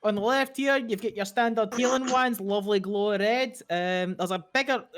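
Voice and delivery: high, quick Irish shopkeeper; chirpy Irish sales pitch; Irish twang. Acted. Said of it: "she put, this is your left".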